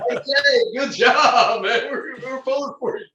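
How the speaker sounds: a buddy yelling his support from the stands, laughing; laughing imitation of a friend. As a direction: ham up the big linebacker cheering him on while cracking up. Friend voiced, speaker laughing.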